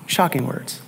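a quiet reflective echo; hushed echo. Acted. Echo of the same phrase.

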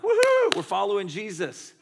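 very high, loud whoop, then cheerful; mock enthusiasm. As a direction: cheer like an eager new follower. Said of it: "in a moment of enthusiasm".

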